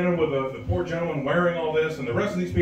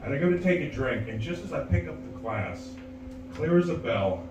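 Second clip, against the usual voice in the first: ordinary talk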